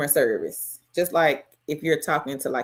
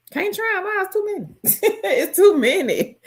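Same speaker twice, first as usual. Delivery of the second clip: laughing; 13 semitones higher than usual. Real, not acted. Laughing at herself.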